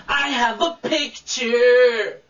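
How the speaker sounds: slow mock show-off DJ singing the lyric; condescending DJ. DJ showing him how to sing, slow mock condescension. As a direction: drag the line out smugly as the DJ. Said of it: "Totally condescending and rude".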